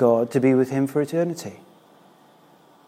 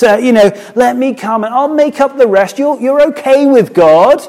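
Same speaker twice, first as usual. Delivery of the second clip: lightly mocked chummy reassurance, pitched up; hammed-up matey praise, higher and louder; chummy flattery; 5 semitones higher, 4 dB louder than usual. Preacher imagining Jesus letting Nicodemus off, chummy flattery.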